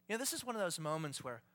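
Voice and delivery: usual speaking voice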